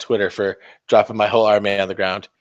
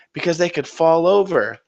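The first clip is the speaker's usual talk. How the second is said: put-on high child's voice answering; pupil Billy; 7 semitones higher than usual. Lightly voiced Billy answering his teacher. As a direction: recite it like an eager kid.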